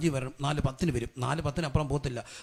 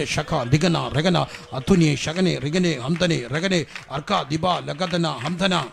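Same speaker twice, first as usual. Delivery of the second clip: rhythmic string of ecstatic syllables; praying in tongues; 7 dB louder, much slower than usual. Real, not acted. Preacher leading congregation's prayer, chanting in tongues. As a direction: pray out in tongues, beat by beat.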